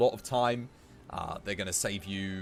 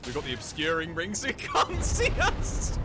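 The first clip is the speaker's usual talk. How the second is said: higher, louder, giggling glee; gleeful giggly; 6 semitones higher, 5 dB louder than usual. Real, not acted. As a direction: sneak past him, delighted. Gleeful at sneaking.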